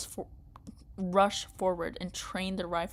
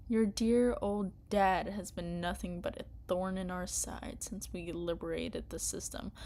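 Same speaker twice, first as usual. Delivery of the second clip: ordinary delivery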